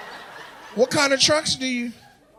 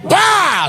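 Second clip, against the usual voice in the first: a loud high startled bah; mock startled shout; 9 semitones higher, 6 dB louder than usual. Comedian acting out a startled man, loud mock yell. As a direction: blurt it out like a sudden scare.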